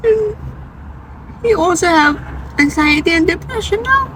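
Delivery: very high, choked-up voice; real tearful voice. Real, not acted. Mother tells of her son's anxiety and depression, tearfully high. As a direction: push the words out through rising tears. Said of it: "I'm gonna be emotional again".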